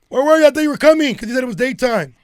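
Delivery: high, loud complaint to a friend; loud accusing demand. Real, not acted. Confronting friend who missed the birthday, loud indignant demand. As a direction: call them out at full volume.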